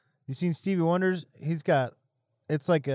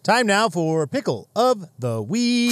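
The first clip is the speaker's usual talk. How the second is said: announcer voice; 5 semitones higher, 6 dB louder than usual. Acted. Announcing the weekly segment.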